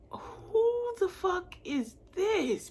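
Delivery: high, drawn-out incredulous question; exaggerated indignant astonishment; outraged disbelief. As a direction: stretch it out in high-pitched shock. Acts out shock at the discovery.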